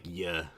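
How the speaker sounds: "yeah" reduced to a short yuh. Shown as a demonstration. Streamer pointing out own accent, "yeah" as yuh. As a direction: say "yeah" like the ya in tell ya. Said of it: "this, like, "yeah".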